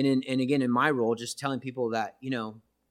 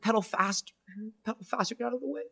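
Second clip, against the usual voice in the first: tearful retold warning; 8 semitones higher, faster than usual. Dad warns daughter before crash.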